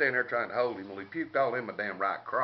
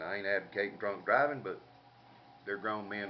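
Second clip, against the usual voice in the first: ordinary talk, nothing marked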